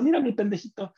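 mock proud dad. Mock father presenting his kid.

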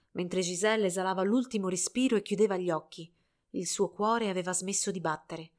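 ordinary delivery

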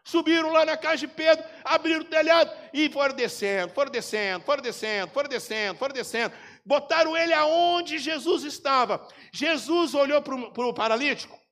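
loud fervent preacher telling the story; preaching at full force. Acted. Pastor preaching loudly to his congregation, fervent. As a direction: preach it out high and loud to the church.

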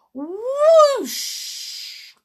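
high, rushing swoop; whooshing effect. A narrator's reading. Boy flying off banister, whooshing sound. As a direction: stretch it like rushing air. Narrator voices flight.

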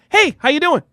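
high-pitched, friendly street hello; upbeat greeting. Acted. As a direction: greet a passerby with high energy. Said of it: "I match their energy".

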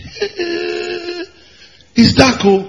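a hammed-up alarmed exclamation; mock panicked God. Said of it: "When God got and said".

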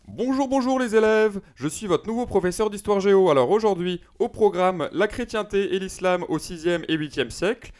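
teacher impression. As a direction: announce the lesson like a new teacher. (imitation aiguë d'un prof d'histoire)

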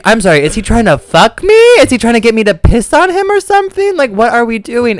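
high-pitched incredulous questioning; baffled disbelief. Retold or imitated. Acts out his baffled inner reaction.

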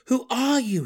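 high, loud repeated demand; demanding shout. Acted. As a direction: yell it, furious and insistent. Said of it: "he demanded again".

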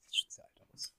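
teasing whisper aside. Real, not acted. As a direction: whisper it as a cheeky jab. Teasing aside about looking old.

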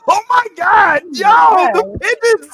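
high, loud shout of disbelief; excited outcry. Real, not acted. Co-host reacts to the abandoned pigeons, excited outcry. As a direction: cry out about the pigeons at full voice.

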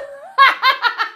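high-pitched burst of laughter; high cackle. Real, not acted. Vlogger amused by Destiny's Child on caller ID, cackling. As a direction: cackle in real disbelief.

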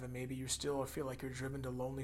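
usual speaking voice

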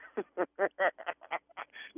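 laughing. Real, not acted. Prankster laughs revealing the prank.

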